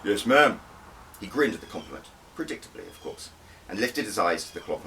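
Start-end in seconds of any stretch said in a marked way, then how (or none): 0.0-0.6 a louder, clipped soldier reply; recruit replying. Acted. The SAS recruit answers his instructor with a loud, eager reply.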